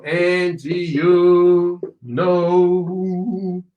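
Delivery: extremely slow, each word held long; drawn-out model phrase. Shown as a demonstration. Teacher demonstrating linked words for students, extremely slow. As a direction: stretch "and you know" syllable by syllable.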